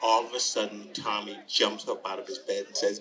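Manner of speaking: usual speaking voice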